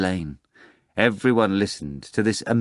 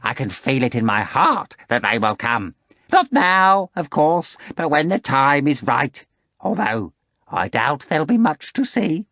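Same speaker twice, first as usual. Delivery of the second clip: raised, louder ingratiating patter; a fussy jester confiding suspicions; Korofiov's fawning voice; 7 semitones higher, 4 dB louder than usual. Acted.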